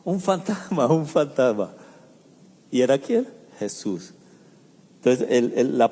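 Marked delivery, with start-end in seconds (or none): 0.1-1.7 first cry pitched higher, then settling; mock panicked shriek. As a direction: play frightened disciples squealing, half-joking. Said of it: "empezaron a gritar desesperados".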